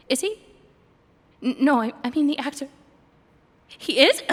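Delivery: high, loud startled questions; starstruck disbelief. Acted. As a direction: gasp at the celebrity. Starstruck by actor.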